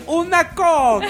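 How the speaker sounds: hammy Italian ordering a coke; Italian accent. Retold or imitated. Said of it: "Ai, que vergonha".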